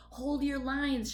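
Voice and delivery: captain shouting orders to his rabbits; shouted order. Acted. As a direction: hold the line, rally the troops. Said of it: "shouted Helmer".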